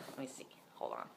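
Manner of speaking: whispered aside to self; whispering. Real, not acted. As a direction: breathe it under your breath. Muttering while checking.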